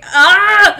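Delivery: loud, high cry at a slip; short high shout; frustrated yell. Real, not acted. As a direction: break off into an exasperated "ah!". Fumbles the tongue twister again.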